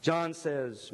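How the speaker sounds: usual speaking voice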